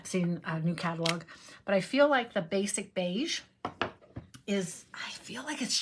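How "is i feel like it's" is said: whispered aside; whispering. Real, not acted. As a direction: breathe it as a secret. Drops to a whisper mid-thought.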